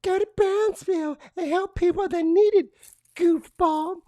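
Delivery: mocking effeminate voice. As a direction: redo the line as a prissy caricature. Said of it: "Wait, let me start over".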